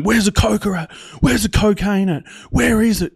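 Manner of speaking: high, loud repeated demand; insistent demand. Retold or imitated. Officer searching a traveller for cocaine, demanding. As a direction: press him hard, pitched right up.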